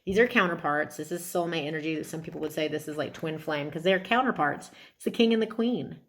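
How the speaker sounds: ordinary talk, nothing marked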